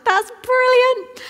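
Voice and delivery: high, slow, exaggerated praise; mum praising. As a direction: gush over the child, drawn out. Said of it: "And I'm like".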